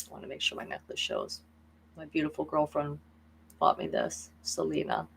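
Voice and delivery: soft whispered aside. Real, not acted. Vlogger whispering an aside about her necklace, hushed. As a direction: whisper it off to the side.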